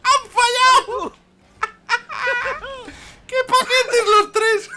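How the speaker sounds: high, slow laughing yells; laughing shout. Real, not acted. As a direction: shriek it while laughing. Laughing as opponents miss.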